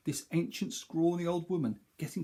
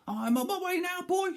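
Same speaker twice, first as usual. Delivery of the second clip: very high, louder crone calling down; calling old crone; 4 dB louder than usual. Acted. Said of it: "she called down".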